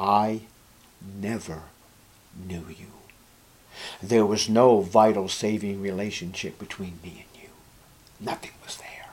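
low, soft, fading toward a whisper; hushed rejection. Acted. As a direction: pronounce the verdict very quietly, with pauses. Voicing Jesus's final rejection.

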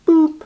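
very high short boop; comic boop. Acted. Preacher marks Pharaoh vanishing with a high boop. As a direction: pop it like a bubble vanishing. Esau gone.

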